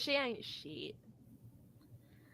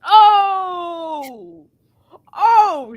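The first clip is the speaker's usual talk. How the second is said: loud, high mock-shocked cry; over-the-top gasp of shock; 5 semitones higher, 7 dB louder than usual. Real, not acted. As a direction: react like a burn just landed. Reacting to a burn.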